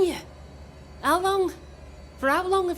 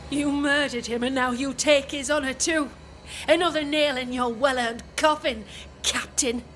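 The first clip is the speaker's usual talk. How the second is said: angry. Acted. Wife accuses the captain.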